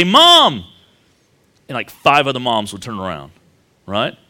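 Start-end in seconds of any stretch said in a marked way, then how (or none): 0.0-0.6 put-on loud child's call across a room. Kid calling his mom after church. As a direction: shout for mom across the foyer.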